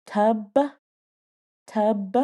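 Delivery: tub with an extra uh sound at the end, tub-uh; imitating the kid saying tub with a trailing uh; kid's wrong tub. Little boy spelling test saying tub with an added uh. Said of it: "Lucas goes".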